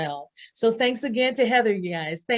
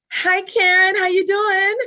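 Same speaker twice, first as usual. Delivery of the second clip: excited greeting; 10 semitones higher, 6 dB louder, slower than usual. Real, not acted. Host greets guest on call.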